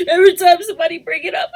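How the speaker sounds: high tearful voice, cracking; sobbing it out, rushed then breaking; crying. Real, not acted. Mother admitting she wants to cry, tearful. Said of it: "I just wanna cry".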